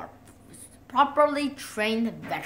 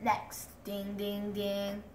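ordinary talk, nothing marked

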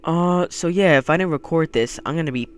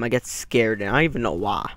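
ordinary talk

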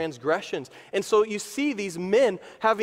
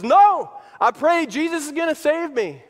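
high, loud stubborn shout; acted, insistent holdout; defiant refusal; 9 semitones higher, 6 dB louder than usual. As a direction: shout back a firm refusal. Acted man refusing rescue.

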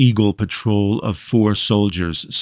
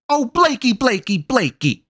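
cooing mother. A narrator's reading. Mother coos at son.

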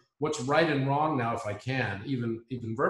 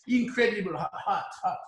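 higher-pitched imitation of a French man's English with a heavy French accent; 6 semitones higher than usual. French guy praising Robert, in a heavy French accent. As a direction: play the French guy lecturing him, in a thick French accent. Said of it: "very heavy French accent".

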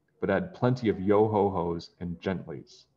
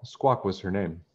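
usual speaking voice

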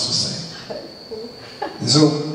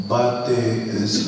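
ordinary delivery

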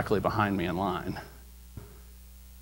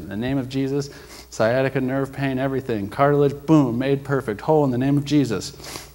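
loud, higher commanding prayer; healing prayer; 4 semitones higher, 5 dB louder than usual. Retold or imitated. Praying over the stranger's hip, loud and commanding. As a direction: declare the healing with force.

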